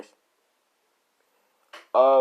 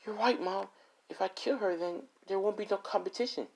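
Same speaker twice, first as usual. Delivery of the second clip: sweet high voice agreeing with Mom; high daughter voice; 11 semitones higher, 4 dB quieter than usual. Acted. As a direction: pitch up high as the eager daughter. Daughter answering mother in story.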